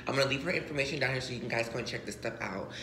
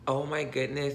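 usual speaking voice

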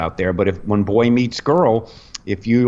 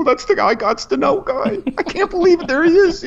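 starstruck excitement. Retold or imitated. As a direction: gush like a starstruck fan.

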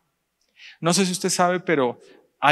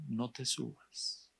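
soft, slow little voice, very quiet; a gentle inner voice warning him softly; small voice; 3 semitones lower, 16 dB quieter, much slower than usual. Acted. The still small voice warns the brother, hushed and slow. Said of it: "escuchó una vocecita que le dijo".

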